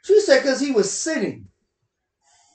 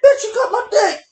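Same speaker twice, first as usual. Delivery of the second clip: loud, higher yell cut with laughter; mock angry yell; 8 semitones higher, 7 dB louder than usual. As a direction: shout it half laughing, as the man in pain. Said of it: "That's what I've been saying in the background".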